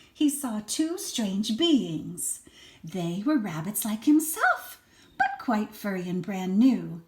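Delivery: put-on lively read-aloud for a small child; expressive storybook reading; story narration. A narrator's reading. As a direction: read it like a bedtime picture book. Reads picture book to child.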